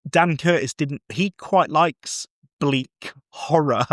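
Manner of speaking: normal talking voice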